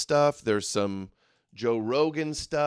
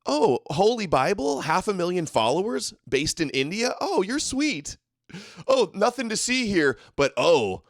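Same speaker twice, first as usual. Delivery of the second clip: mock-excited e-crusader, swooping high; 5 semitones higher than usual. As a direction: put on a high-pitched gushing mock voice.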